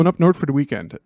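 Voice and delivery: quick Minnesota accent, words run together; fast Minnesota accent. Shown as a demonstration. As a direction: rattle off "north for the weekend" fast in a Minnesota accent. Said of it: "just say it fast".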